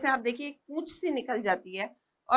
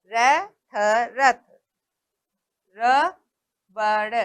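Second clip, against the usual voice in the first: spelling out रथ slowly, letter by letter; slow syllable-by-syllable teacher reading; sounding out slowly; much slower than usual. Shown as a demonstration. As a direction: break रथ into र and थ, then join them. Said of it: "spelling out slowly".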